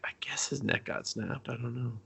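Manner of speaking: unvoiced whispered aside; hushed, voiceless mutter. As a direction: breathe it out, unsure. Confused aside about neck snap.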